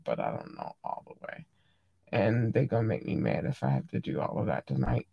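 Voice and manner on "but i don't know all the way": breathy whispered aside. Real, not acted. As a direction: whisper it under your breath. Trails off under breath.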